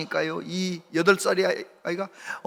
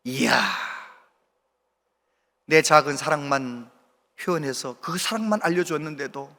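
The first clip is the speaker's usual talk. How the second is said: ordinary delivery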